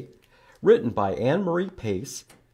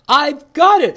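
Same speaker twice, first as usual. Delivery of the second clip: much higher, louder squeal of an idea; excited squirrel; 14 dB louder than usual. Acted. Squirrel's idea in picture book.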